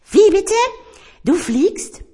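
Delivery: ungläubig und entrüstet losfragen. Acted. Tiger astonished, scolding. (Tiger fragt den Teppich entrüstet aus)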